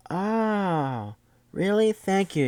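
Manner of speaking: high-pitched imitation of grateful surprise; exaggerated, high "oh, really?" reaction; mock surprised gratitude. Retold woman's reply to rescuer.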